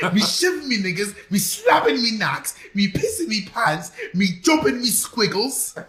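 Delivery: high, loud comic British beggar. Pretend. Host doing a requested beggar bit, high loud comic wail. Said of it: "Can you please do your bagger?".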